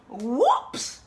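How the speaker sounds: loud, high, rising whoops; old lady's whoops. Acted. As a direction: pop it out in mock alarm. Little old lady's blunder cry.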